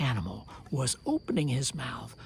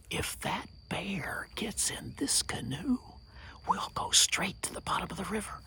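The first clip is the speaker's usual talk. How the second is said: whispered worried thought; 4 semitones higher, 5 dB quieter than usual. Acted. Turtle privately worries about the bear, whispered. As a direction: think it aloud under your breath.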